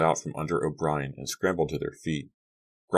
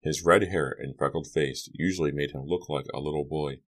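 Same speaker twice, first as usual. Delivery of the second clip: ordinary delivery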